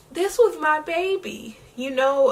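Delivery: the same words, now breaking into tears; real crying. Real, not acted. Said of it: "Y'all got me crying".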